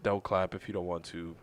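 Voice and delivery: ordinary talk, nothing marked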